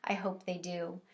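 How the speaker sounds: usual speaking voice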